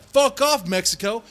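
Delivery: loud, high, defiant shout; defiant yell. Retold or imitated. A Texan telling invading Mexico off, defiant yell. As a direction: bark it at the invaders crossing the border. Voicing Texans repelling invasion.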